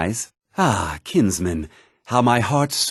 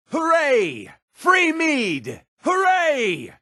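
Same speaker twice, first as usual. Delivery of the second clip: put-on high, whooping drunken hooray; drunken cheer; much slower than usual. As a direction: cheer like a tipsy reveller buying rounds.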